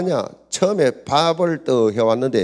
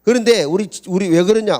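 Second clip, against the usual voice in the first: ordinary talk, nothing marked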